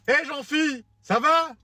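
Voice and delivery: high, bright mock greeting of a pal. Driver greeting a friend through the window, high and cheery. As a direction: squeak out a cheery hello.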